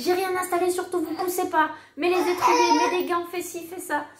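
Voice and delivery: high, urgent rapid orders; hurried midwife orders. Retold or imitated. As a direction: fire off orders in a rush. Midwife rushing, giving orders.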